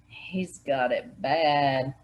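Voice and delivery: slow, sighing and resigned; sighed. A narrator's reading. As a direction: let it out on a long sigh. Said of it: "Barney sighed".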